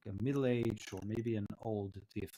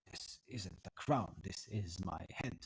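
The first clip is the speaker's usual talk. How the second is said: a touch lower and softer, understated correction; lightly voiced old tiefling; 4 semitones lower, 6 dB quieter than usual. Old tiefling corrects the visitor who admired his crown, lightly voiced and subdued. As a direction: quietly correct a mistaken visitor, slightly low. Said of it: "looks at you and says".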